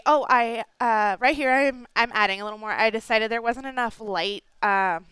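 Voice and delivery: ordinary talk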